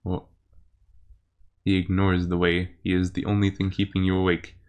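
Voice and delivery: game master narration. Acted.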